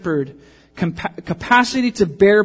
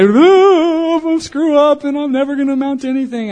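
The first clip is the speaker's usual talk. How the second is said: put-on very high, loud, fast whimpering teen; whiny self-pity; 11 semitones higher, 10 dB louder than usual. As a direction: wail like a sulky kid at a pity party.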